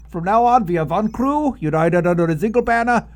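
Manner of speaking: loud rousing declaration; rally the crew as one; rallying speech. Acted.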